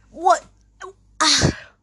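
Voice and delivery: high, loud shocked cry of What; a sharp furious What, then a gasped Ah; outraged disbelief. Real, not acted. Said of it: "I'm furious".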